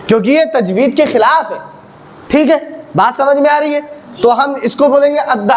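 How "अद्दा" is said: अद्दा with a light, thin D; आराम से. Shown as a demonstration. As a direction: say अद्दा gently, unrounded. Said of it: "आराम से बोलेंगे". (अद्दा को आराम से, महीन)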